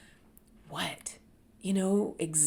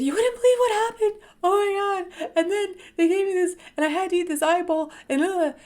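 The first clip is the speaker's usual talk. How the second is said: much higher, louder, fast wailing rant; upset retelling; 11 semitones higher, 7 dB louder than usual. Retold or imitated. Venting to a friend about the eyeball, high crying wail. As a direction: pour it all out in a high, crying rush.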